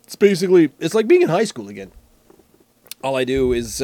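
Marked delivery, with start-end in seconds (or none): none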